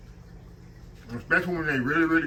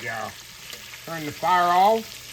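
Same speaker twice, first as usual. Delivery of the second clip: usual speaking voice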